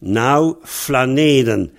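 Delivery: Nau flaneden with a clear F for the P; spirant mutation. Shown as a demonstration. Teacher shows the student the spirant mutation, slowly. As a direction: slowly show the P turning to F in flaneden. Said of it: "c'est la mutation spirante".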